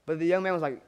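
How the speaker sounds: ordinary delivery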